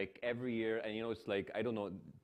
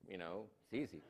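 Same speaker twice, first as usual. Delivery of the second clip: normal talking voice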